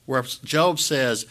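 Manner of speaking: normal talking voice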